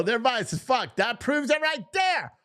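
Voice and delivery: high, exclaiming indignation; worked-up outrage. Real, not acted. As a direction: burst out as if catching them red-handed. Outraged reaction to the tweet read.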